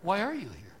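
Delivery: a doorkeeper losing patience; exasperated Peter. Acted. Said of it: "Saint Peter, in this imagined story, says".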